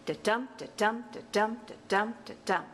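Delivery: five even "da-DUM" units, stress on each "dum"; rhythmic "da-DUM", falling off on the last one; iambic pentameter beat. Shown as a demonstration. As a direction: chant "da-dum" like a metronome, light then heavy. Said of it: "perfect iambic pentameter would sound like".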